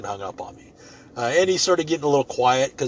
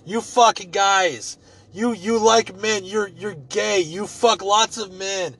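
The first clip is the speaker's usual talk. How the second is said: mock insulting rant; 8 semitones higher, 3 dB louder, slower than usual. Scammer cursing him out.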